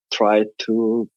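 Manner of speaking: normal talking voice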